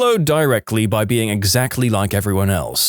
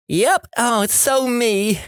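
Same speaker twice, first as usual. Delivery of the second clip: much higher, slow sing-song mockery; mocking smug voice; 11 semitones higher, slower than usual. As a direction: parody a smug poster. Mocking a smug post reader.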